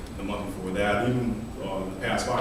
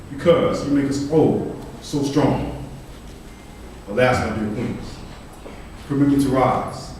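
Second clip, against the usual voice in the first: measured, declamatory poetry reading; poem recitation; 5 semitones higher, 4 dB louder than usual. Acted. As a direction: recite it as an earnest address to an audience. Reads own poem aloud.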